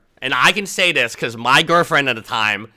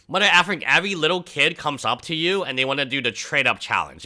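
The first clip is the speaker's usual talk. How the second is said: normal talking voice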